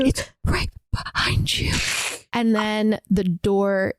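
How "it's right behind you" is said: hushed, unvoiced mock-terrified warning; scared whisper. Retelling the scared girl warning her sister, frightened whisper. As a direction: whisper it as if it might hear.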